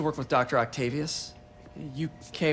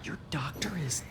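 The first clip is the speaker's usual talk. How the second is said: hushed, breathy secret whisper; 9 dB quieter than usual. Acted. Player's inner whisper, ominous thought.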